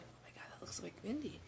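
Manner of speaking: wife spotting her friend across the street, whispering; surprised whisper. Retold or imitated. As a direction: whisper it so he won't hear.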